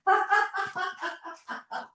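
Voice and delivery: long high staccato laugh; high motorbike-engine giggle; laughing while driving. Shown as a demonstration. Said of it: "drive it round your room while laughing".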